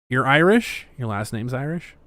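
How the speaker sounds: usual speaking voice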